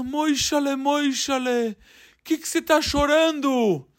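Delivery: a talking idol calling to the crying man; statue voice. Acted. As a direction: call him in as a sly idol. The statue speaks to him.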